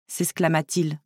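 plain reading voice

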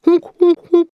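high honk honk; high playful honks; animal honk. A narrator's reading. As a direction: give a little honking call. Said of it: "en petit lapin".

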